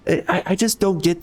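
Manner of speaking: pitched well up in disbelief; exasperated outburst. Real, not acted. Streamer ranting at demanding fans, pitched high and exasperated. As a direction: fire it back at them, incredulous.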